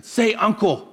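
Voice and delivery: high, forceful "say uncle"; bullying demand. Acted. Bully's demand in a retold fight.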